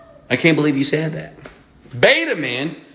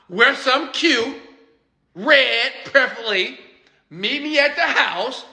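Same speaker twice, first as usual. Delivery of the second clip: put-on confident boyfriend; 10 semitones higher than usual. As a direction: lay out the plan like you own the evening.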